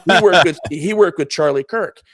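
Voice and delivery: high and loud, gushing about Bryce; excited young woman. Retold or imitated. Young woman at the bar telling her boyfriend about Bryce, high excited gush.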